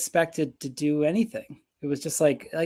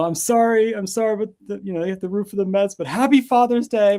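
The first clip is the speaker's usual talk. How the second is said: high-pitched imitation of an exasperated fan; mock apology, raised pitch, pushing louder on the greeting; Frank the Tank; 5 semitones higher, 4 dB louder than usual. Fan recording a Father's Day video, imitated exasperation. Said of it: "Frank the Tank being like".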